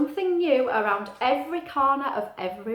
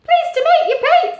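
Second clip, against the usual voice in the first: high, loud chirpy bird voice; little bird voice; 12 dB louder than usual. Acted. Little bird greeting Pete, high and loud. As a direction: squeak it out like a tiny bird.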